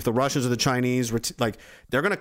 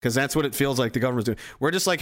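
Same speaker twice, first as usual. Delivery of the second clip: normal talking voice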